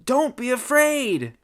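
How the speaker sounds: high, loud, drawn-out reassurance; calling out. Acted. Robin reassuring faraway Darla, calling out loudly. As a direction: call it slowly across a distance.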